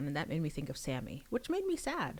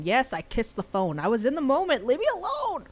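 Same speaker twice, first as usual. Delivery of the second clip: excited gushing; 9 semitones higher, 10 dB louder than usual. Real, not acted. Reader gushing about the scene.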